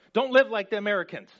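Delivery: a hammed-up stern apostle; imagined command. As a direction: fire off the line as Paul would. Imagined Paul letter, louder.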